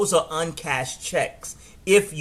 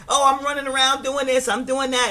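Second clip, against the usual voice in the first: mocking phone friend; 9 semitones higher than usual.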